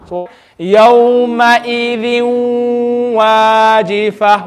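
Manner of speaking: yawma idhin wajifa drawn out slowly with rounded lips on wa; slow recitation. Shown as a demonstration. Teacher models recitation, slow and drawn out. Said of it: "make our lips round".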